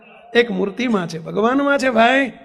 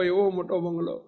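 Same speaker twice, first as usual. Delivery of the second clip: softer echo; 6 semitones lower, 8 dB quieter than usual. Shown as a demonstration. Preacher to congregation, echoing the phrase, much softer. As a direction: repeat એવો મોટો બંગલો much softer and lower.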